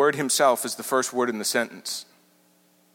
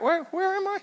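much higher and softer, bewildered; a befuddled stray, small and confused; lost wanderer; 5 dB quieter than usual. Pretend. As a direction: sound like someone who suddenly has no idea where they are.

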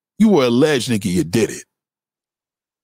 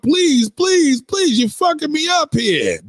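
mock pleading; 12 semitones higher, slower than usual. Accused man begging the woman, hammed-up high pleading. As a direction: beg in a hammed-up high wail. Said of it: "remember when [name]".

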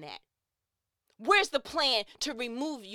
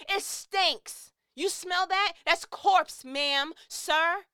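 worked up; 6 semitones higher, 4 dB louder, much slower than usual. Real, not acted. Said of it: "'cause I'm worked up".

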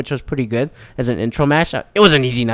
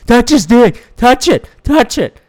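high-pitched heckler chant, louder; pushed-up, insistent mock yell; mock screaming; 7 semitones higher, 4 dB louder, slower than usual. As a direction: imitate a drunk fan hollering. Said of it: "this motherfucker kept screaming".

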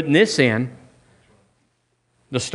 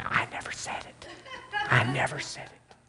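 hushed, breathless whisper to himself; whispered aside; 12 dB quieter than usual. Retold or imitated. Preacher alone in his car after service, whispering. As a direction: whisper it, half laughing. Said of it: "I'll go to the car and say".

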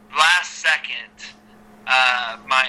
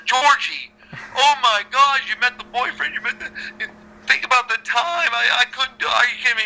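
exasperated protest; 9 semitones higher, faster than usual. Retold or imitated. Protesting innocence to Georgie.

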